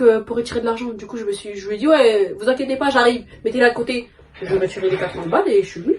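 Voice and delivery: ordinary talk, nothing marked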